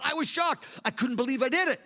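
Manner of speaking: ordinary delivery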